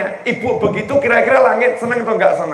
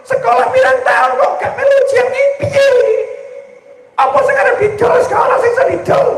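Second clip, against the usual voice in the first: shrill mock complaint; faster than usual. Opening clip, high-pitched shouting.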